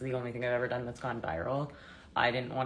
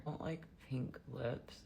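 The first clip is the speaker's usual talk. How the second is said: ordinary delivery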